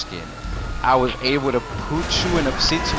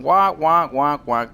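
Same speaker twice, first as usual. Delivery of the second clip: loud muffled trombone-like wonk; mock the cartoon teacher's droning wonk; cartoon adult droning noise; Charlie Brown teacher; 3 semitones higher, 4 dB louder, slower than usual. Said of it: "where you hear the teacher talking".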